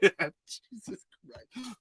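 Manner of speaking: ordinary talk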